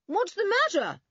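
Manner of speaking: high, carrying call. A narrator's reading. Boy in the pool to an angry man, calling out. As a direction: call it across the water. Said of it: "called Julian".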